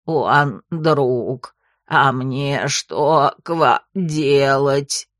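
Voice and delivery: low, slow croaking toad; gurgling frog voice. A narrator's reading. Frog character voice.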